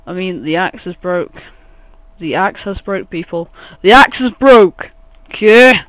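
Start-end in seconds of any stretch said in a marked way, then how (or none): 3.8-5.8 loud, higher, mock-outraged announcement; loud, insistent yell; exasperated shout. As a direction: shout it as if nobody listened. Repeats the correction, now shouting.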